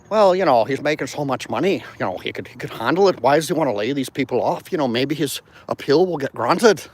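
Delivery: a light mock Irish accent, quoting a rival. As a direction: put on an Irish lilt to voice the Irish demon's argument. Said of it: "I like doing the Irish accent thing".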